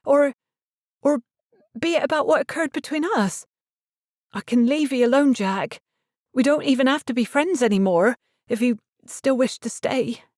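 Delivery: put-on anxious young woman, pleading; Gwynna's voice. A narrator's reading. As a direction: voice her as an earnest young maid offering to leave.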